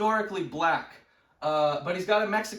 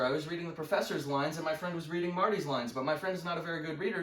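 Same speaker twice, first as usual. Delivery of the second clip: usual speaking voice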